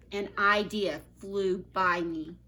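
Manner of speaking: ordinary delivery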